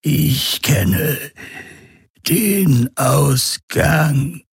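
high, very slow alien voice; Urus Khan. A narrator's reading. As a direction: draw out each word with long gaps. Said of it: "die Stimme des Urus Khan". (hohe, gedehnte Geisterstimme)

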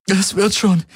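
high, stress on "wird" as she insists; holding back a sob. Acted. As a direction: press hard on "wird", choking back tears. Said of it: "unterdrückte ein Schluchzen".

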